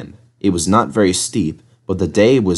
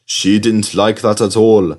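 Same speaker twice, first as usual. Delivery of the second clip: ordinary delivery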